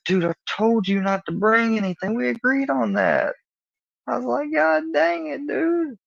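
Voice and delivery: hammed-up frustrated yelp at a friend; scolding a friend who brought weed, shrill exasperation; exasperated outburst. Retold exasperation at hidden weed.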